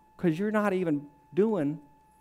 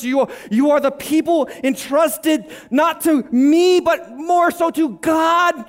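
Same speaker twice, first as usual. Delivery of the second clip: loud, high, slow, drawn-out declamation; full-force preaching; 8 semitones higher, 6 dB louder, slower than usual. Real, not acted. Preacher declaring whom he answers to, full-force preaching. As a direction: thunder it out to the congregation.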